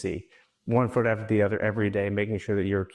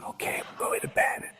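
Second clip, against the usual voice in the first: put-on toddler's reply in a whisper; 3 dB quieter than usual. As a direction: whisper back sleepily. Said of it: "I do it the whisper voice".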